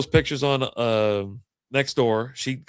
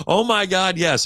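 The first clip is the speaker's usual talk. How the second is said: high, louder burst of relief; relieved owner; 6 semitones higher, 5 dB louder, slower than usual. Retold or imitated. Owner told the lost dog is found, relieved. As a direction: gush as the owner hearing good news.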